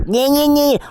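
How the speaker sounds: high-pitched sing-out whine; mocking whiny voice. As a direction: mimic a whiner with a high, drawn-out nyeh. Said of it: "whiny little bitch voice".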